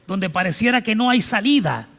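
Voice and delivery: "hay" driven home loud and high. Acted. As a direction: build the line up to "hay". Repeated line, preached louder.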